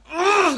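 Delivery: sharp, high, loud groan of frustration; a fed-up "ugh", close to losing it; exasperated groan. Retold or imitated. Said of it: "going insane".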